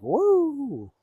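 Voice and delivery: high whooping cheer; drunk whoop. Retold or imitated. Tipsy biker whooping at the campfire, high. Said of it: "and I'm like".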